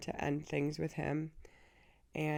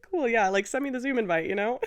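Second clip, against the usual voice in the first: mock breezy; 5 semitones higher, 7 dB louder than usual. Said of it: "And I'm like".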